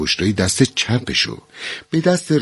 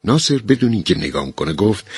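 plain reading voice

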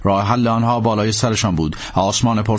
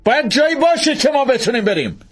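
high, urgent call; urgent Langdon. A narrator's reading. Langdon urging the priest to fly, high urgent call. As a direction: push him to take off.

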